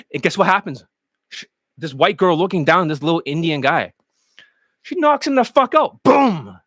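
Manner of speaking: ordinary talk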